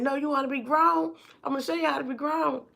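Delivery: a mother promising a whooping; retold threatening mom. Mama at bottom of stairs.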